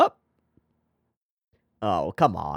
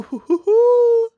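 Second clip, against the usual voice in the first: slow, very high, loud hoo hoo hoo; high excited hooting; 7 dB louder, slower than usual. Real, not acted. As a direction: hoot with delight. Excited reaction to game.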